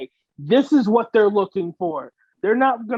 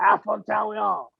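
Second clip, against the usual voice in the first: higher, hammed-up French accent; cartoon French; mock French accent; 4 semitones higher than usual. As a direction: parody Frenchman naming landmarks. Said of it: "with fucking French accent".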